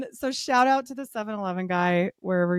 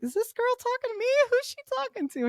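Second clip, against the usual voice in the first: incredulous; faster than usual. Retold or imitated. Retells confusion at substitute teacher.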